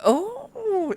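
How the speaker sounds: high, breathy little lady's "oh"; a swooning noble lady; Annette's voice. Acted. GM voicing Annette's gasp.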